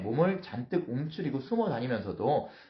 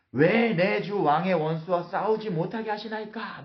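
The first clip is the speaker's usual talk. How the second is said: high, slow, drawn-out acted protest; brazen bluster; 7 semitones higher, 3 dB louder than usual. David feigning eagerness before Achish, brazen bluster. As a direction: protest boldly, stretching the words. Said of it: "막 똥배짱을 부려가면서".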